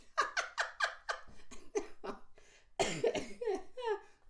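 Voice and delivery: a burst of high breathy laughter; high airy laugh. Real, not acted. Streamer laughing at her own voice joke, high airy laugh.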